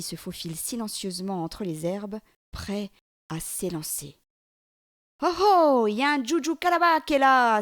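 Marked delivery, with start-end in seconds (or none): 5.2-7.6 high, loud warning call; a boy's loud high mocking alarm; crying out. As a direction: shout the alarm to the gazelle. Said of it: "s'écrie Zékéyé".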